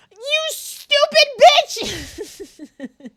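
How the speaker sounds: drawn-out high scream of outrage; visceral scream. Pretend. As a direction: scream it slowly from the gut. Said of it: "that was so visceral".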